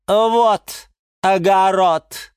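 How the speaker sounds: high, loud, very drawn-out jibe; cutting put-down. A narrator's reading. Orchid cuts down the geranium's ambitions, scornful. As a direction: stretch each word with scorn. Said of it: "припечатала орхидея Галатея".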